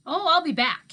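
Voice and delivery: put-on easygoing old man talking to kids; Mookie's voice. A narrator's reading.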